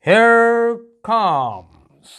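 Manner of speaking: loud, high, drawn-out showman introduction; stage compere announcing. Acted. Teacher playing a stage compere introducing someone, loud showy announcement. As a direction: boom it out like an MC building up the star. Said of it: "compare जो है वो announce कर रहा है".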